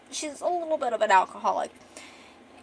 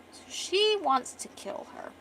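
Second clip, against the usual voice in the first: ordinary delivery, nothing marked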